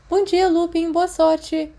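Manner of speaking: lightly voiced Peter, rushing out cheerfully; quick cheery goodbye. Peter dashing out the door, calling a quick goodbye. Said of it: "correndo porta fora com um rápido".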